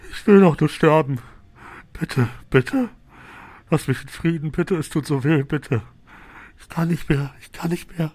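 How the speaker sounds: wailing, pleading through sobs; snotty crying. Acted. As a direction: beg while bawling like a child. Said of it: "weint ... wie ein kleines Kind; heult wirklich Rotz und Wasser".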